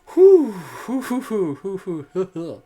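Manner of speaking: loud, high panting whews that fade; catching breath after laughing. Real, not acted. Host recovers from her laughing fit, panting.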